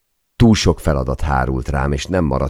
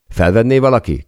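raised, higher request to the room; calling out; 9 semitones higher, 5 dB louder than usual. A narrator's reading. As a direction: call out for someone to pick up. Calls out to the office. (fennhangon odaszólva)

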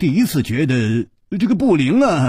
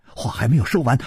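ordinary delivery

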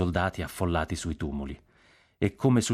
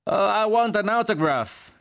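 very high, loud, drawn-out yell; shouted exclamation; 5 dB louder, slower than usual. A narrator's reading. G.I. yells on hearing of famous actor, shouted exclamation. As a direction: holler it from back in the crowd. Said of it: "gridò un G I".

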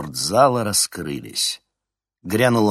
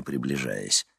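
ordinary delivery, nothing marked

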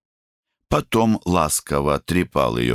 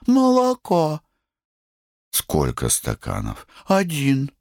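высокий капризный голосок; требуй по-детски, высоко; капризная девочка; slower than usual. A narrator's reading. (капризный детский голосок)

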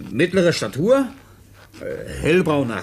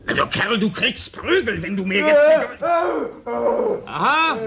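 rising angry threat; 8 semitones higher than usual. Acted. Officer threatening the foundling with a beating, rising angry. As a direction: build the threat until it snaps. Threatening, then boy reacts.

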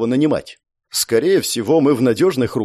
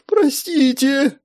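high, slow frightened plea; tearful plea; 12 semitones higher, much slower than usual. A narrator's reading. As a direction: beg through near tears. Girl about to cry.

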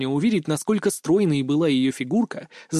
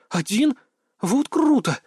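high-pitched, wowed exclamation; delighted admiration; 9 semitones higher, slower than usual. A narrator's reading. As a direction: marvel at it, voice leaping up. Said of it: "восхитилась она". (с восхищением, высоко)

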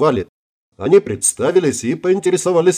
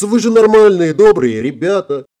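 high, loud pleading start, easing off; acted girlish appeal; mock innocence; 7 semitones higher, 4 dB louder than usual. A narrator's reading. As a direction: plead like a naive helpless girl. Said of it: "захлопала глазами Вильямс".

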